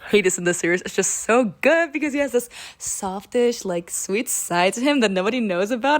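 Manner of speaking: ordinary delivery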